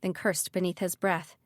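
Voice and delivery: usual speaking voice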